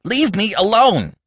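high-pitched indignant cry; protesting. A narrator's reading. Robbie wanting to be left alone, protesting. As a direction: protest loudly to push him away. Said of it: "protested Robbie".

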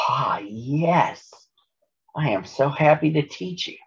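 lifted, happy relief; heartfelt delight. A narrator's reading. Grover offering to teach Molly jacks, sincerely delighted. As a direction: brighten up, eager and sincere. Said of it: "And he really meant it".

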